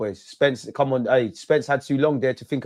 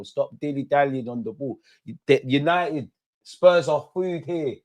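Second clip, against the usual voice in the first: ordinary talk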